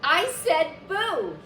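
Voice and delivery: loud, high angry shout; a furious ghost shouting boo. A narrator's reading. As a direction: yell it to scare him off. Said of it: "he shouted".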